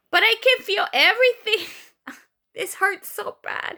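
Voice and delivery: high, whiny plea of pain; distressed protest. Retold or imitated. Patient protesting to doctor that it hurts, acted-out distress. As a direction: wail it out in mock distress.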